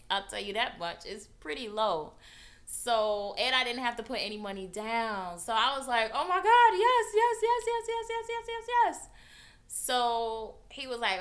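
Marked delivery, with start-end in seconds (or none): 6.1-9.1 high, loud ecstatic yeses; overjoyed. Retold or imitated. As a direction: squeal yes over and over in joy. Overjoyed accepting the deal.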